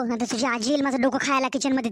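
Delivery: usual speaking voice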